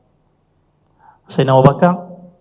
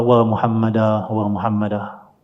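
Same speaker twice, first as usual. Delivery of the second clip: slow, subdued lament trailing softer; mild retold lament; 4 semitones lower, 5 dB quieter, slower than usual. Abu Bakar missing the Prophet at night, understated lament. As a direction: draw the grieving call out slowly and quietly.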